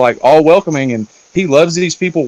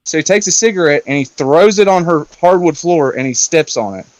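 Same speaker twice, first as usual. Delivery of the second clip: ordinary delivery, nothing marked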